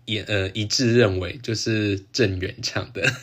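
ordinary talk, nothing marked

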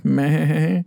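a playful mock bleat; sheep bleat imitation.

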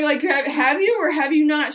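exasperated mock interrogation; incredulous questioning. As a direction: press for a straight answer. Retold alarmed questioning of novice shooter.

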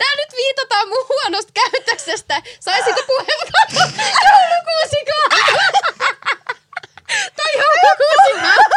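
high, loud, laughing through it; pleading for a turn while cracking up; laughing, slow, high protest. Real, not acted. Laughing protest among friends.

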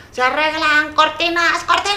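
street vendor call. Retold or imitated. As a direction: sing out like the curtain repairman. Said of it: "pasa un señor".

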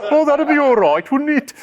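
high, laughing mock Birmingham accent. Host tries a laughing Birmingham accent on the audience. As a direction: toss it off in a jokey Brummie voice through laughter. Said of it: "we could do it with a Birmingham accent".

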